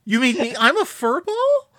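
wounded, high-pitched disbelief, rising at the end; crushed. Retold or imitated. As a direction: imitate a hurt cartoon cat questioning an insult. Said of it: "He just sounds absolutely crushed".

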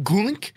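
high-pitched cartoon swallowing noise; imitated gulp. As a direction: mimic a gulp, high and quick.

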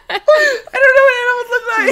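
laughing wail. Real, not acted. Laughing exasperated cry.

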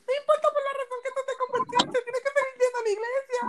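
high, mock-sweet girl telling him to keep going to church; mimicking the girl. Teller imitates the girl scolding him, in a high girlish voice. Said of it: "Tú sabes lo que yo me dijo?".

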